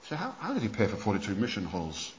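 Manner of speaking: ordinary delivery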